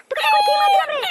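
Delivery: furious yell. Acted. Angry wife shouting at husband she just beat. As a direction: yell it while beating him.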